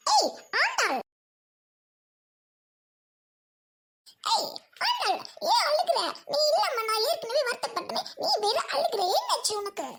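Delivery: high, swooping put-on voice; exaggerated high-pitched concern; excitable friend. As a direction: call out in a squeaky sing-song.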